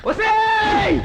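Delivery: high, loud call across the room; sharp shout. Acted. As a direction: yell her name to stop her. Man calls out to woman sharply. (గట్టిగా అరుపు)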